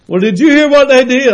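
high, loud eager gossip; pushy gossip. Retold or imitated. Preacher voicing a pushy church gossip, high and loud. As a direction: push the juicy news on him.

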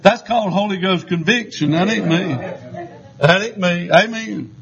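ordinary talk

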